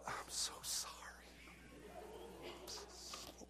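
hushed, drawn-out whispered apology; pitying whisper. Retold or imitated. Officer thinking of the punished boy, pitying whisper. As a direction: whisper it as a private thought.

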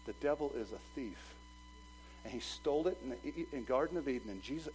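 ordinary talk

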